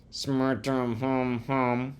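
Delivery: slow, humming mumble of SMIRTUM HUM HUM; unintelligible mumble. A narrator's reading. Pupil answering teacher, "Smirtum hum hum" mumbled beyond understanding. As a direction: run "Smirtum hum hum" together as drawn-out nonsense. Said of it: "say it so I can understand".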